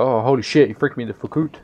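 put-on Canadian accent, "out" becomes "oot". Imitating Canadians from Trailer Park Boys, Canadian accent. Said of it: "They go".

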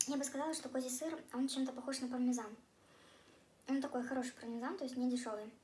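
chewing while talking; eating during speech; mouth full. Real, not acted. Seen 0: Lips pressed, cheeks moving as if chewing between sips and bites.

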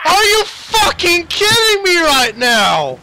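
exasperated. Real, not acted. Friend crashes again.

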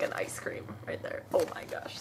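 whispered delight; hushed oh-my-gosh. Real, not acted. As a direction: drop into a thrilled whisper. Spots ice cream on sticker.

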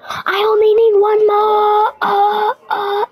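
mock wail. Frustrated player one piece of wood short, mock wail. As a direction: wail it like a hammed-up sob. Whining over one missing log.

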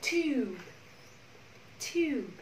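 "Tube" with t run straight into a long yu; sounds joined together. Shown as a demonstration. Teacher joining the sounds for students, careful "Tube". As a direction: model "Tube" as one drawn-out word, pausing after. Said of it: "Nối lại với nhau".

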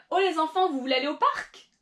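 acted question to children, PARC accentuated at the end; stress on last word. Shown as a demonstration. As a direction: call to the kids, landing on a rising, stressed "parc". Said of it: "accentuating kind of that last word a little bit".

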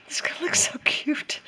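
giddy whisper; excited hushed whisper. Real, not acted. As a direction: whisper it with delight. Excited crafting, whispered aside.

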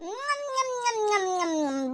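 soft, rhythmic munching hums; savouring "mm-mm" chewing noises; relishing munching. Acted. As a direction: hum through noisy, happy mouthfuls. Eating the biryani with relish.

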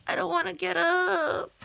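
hammed-up crying whine; whiny moan.

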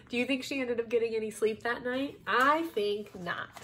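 ordinary delivery

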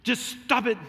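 loud, curt "Stop it"; Bob Newhart's blunt "Stop it". Retold or imitated. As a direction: snap "Stop it" like a no-nonsense therapist. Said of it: "And he goes".

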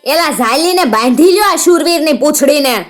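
high-pitched mocking command; angry order. A narrator's reading. Outlaw tells men to tie her up, angry command. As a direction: bark orders to seize her.

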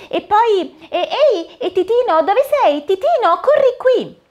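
high, bright-eyed fairy godmother, cheerful and busy; the fairy godmother greets Cinderella at the pumpkin, high and cheery. Acted. Fairy hurries them along.

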